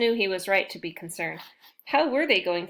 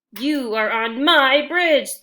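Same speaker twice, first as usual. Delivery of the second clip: slow, booming troll roar, higher and much louder; troll shout; 5 semitones higher, 10 dB louder, slower than usual. Acted. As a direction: bellow like an angry troll guarding his bridge. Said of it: "the troll shouted".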